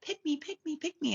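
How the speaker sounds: high squeaky taunt; mocking pick-me. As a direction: pipe it up like a needy pick-me girl. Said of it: "they will literally be like".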